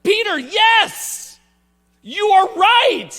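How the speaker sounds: slow, high, loud cheer; Jesus cheering Peter. Retold or imitated. Jesus praising Peter for his right answer, slow loud cheer. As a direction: shout praise like a proud teacher. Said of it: "Jesus was the same way with Peter".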